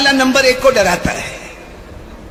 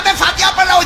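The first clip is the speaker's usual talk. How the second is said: trembling with fright; 7 semitones higher, faster than usual. Acted. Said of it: "گھبرایا ہوا پورا کانپتا کانپتا کہا".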